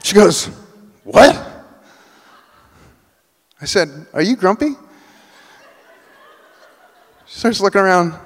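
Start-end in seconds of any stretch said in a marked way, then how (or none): 1.0-1.3 high, loud shouted what; startled shout of what. Retold or imitated. Customer can't hear over machines. 4.1-4.8 higher, rising, called-out question; lifted voice, asking once more; raised question. Retold or imitated. Repeating question over the noise.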